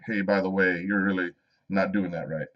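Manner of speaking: imitating a kind friend's quiet correction; gentle private note. Danny privately messaging the host, imitated softly and gently. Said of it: "just super gentle".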